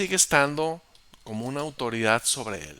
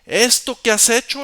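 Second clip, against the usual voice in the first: much higher, much louder denunciation; denouncing shout; 11 semitones higher, 11 dB louder than usual. Acted. David denouncing Abner across the valley, very loud. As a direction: thunder it out.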